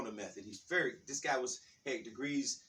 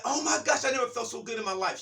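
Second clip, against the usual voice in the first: high, loud and fast rapture; high, loud, fast joy; ecstatic; 9 semitones higher, 6 dB louder than usual. Retold or imitated. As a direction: rush it out in delight. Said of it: "She was like".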